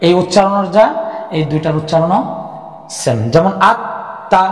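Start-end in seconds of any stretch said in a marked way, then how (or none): 3.7-4.4 আত্মা said slowly, with the ত doubled and held; slow teaching demo of আত্মা with the ত held and doubled; demonstrating tashdid. Shown as a demonstration. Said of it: "এটার উচ্চারণ আত্মা". (তাসদিদসহ ধীরে ধীরে আত্মা উচ্চারণ)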